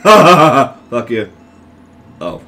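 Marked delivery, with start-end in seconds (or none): 0.0-1.3 louder curse through a laugh; laughing taunt. Real, not acted. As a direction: laugh it at him.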